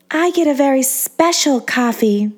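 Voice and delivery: put-on high, airy little-girl voice; high breathy voice, half Marilyn Monroe. A narrator's reading. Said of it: "her high, incredibly breathy voice".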